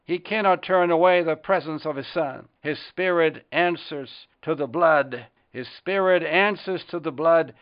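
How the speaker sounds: impassioned poem reading rising from measured to loud; hymn recital. Acted. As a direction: recite the hymn verse like a fervent prayer, building to full preaching force. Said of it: "Let me read the fifth verse".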